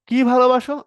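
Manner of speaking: a loud, high mock quiz question; playful loud question. Shown as a demonstration. Teacher acting out a question.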